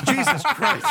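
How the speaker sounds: high, breaking into laughter; can't get it out for laughing; real laughter swallowing the words. Real, not acted. Laughing at the story.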